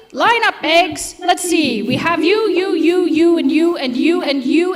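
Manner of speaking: ordinary delivery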